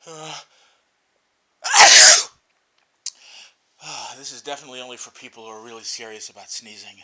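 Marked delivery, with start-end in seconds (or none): 1.6-2.3 sharp breathy sneeze. Real, not acted. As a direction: sneeze out hard. Snuff making him sneeze.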